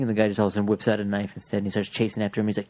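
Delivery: ordinary talk, nothing marked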